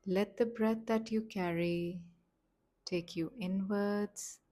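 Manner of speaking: slow, gentle meditation voice with long pauses; softly paced relaxation instructions, leaving space to breathe; guided meditation. Acted. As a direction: guide them calmly, phrase by unhurried phrase.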